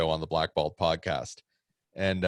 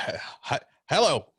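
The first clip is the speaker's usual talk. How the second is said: high, startled stammering voice; scared old man; 6 semitones higher than usual. Retold or imitated. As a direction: stutter out a nervous hello. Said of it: "the go-to "I'm scared" accent".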